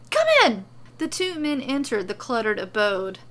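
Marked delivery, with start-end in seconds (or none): 0.1-0.6 very high, loud, insistent call; frantic, high-pitched invitation; urgent shouting. A narrator's reading. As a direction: shout them inside. Excited host repeats more urgently.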